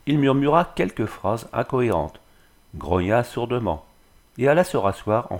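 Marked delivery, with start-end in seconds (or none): none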